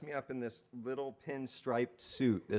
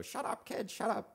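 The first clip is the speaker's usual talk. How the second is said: mock grandma scolding, much higher pitch; 11 semitones higher than usual. Grandma scolding squabbling kids in the car, much higher mock scold. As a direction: snap at the kids like a cross old grandma. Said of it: "my grandma would yell at us".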